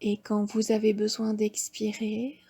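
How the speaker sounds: normal talking voice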